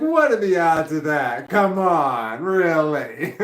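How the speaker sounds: high, loud incredulous scoff; mock group protest. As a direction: push back on the odds, loud and high. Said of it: "we're like".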